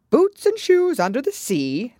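ordinary delivery, nothing marked

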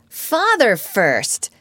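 put-on a wary little boy insisting; suspicious child. A narrator's reading.

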